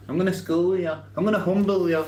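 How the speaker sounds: high, mocking threat; hammed-up playground threat; mocking tough guy. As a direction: send up his tough talk in a high voice. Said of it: "I have".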